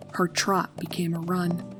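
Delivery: ordinary delivery, nothing marked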